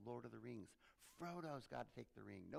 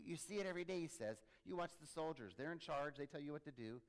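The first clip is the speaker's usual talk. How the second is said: ordinary talk, nothing marked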